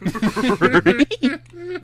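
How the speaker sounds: very high, loud cackle; loud high laughing fit; shrieking laugh. Real, not acted. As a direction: burst into a squealing laugh. Laughs at Scooby-Doo laugh.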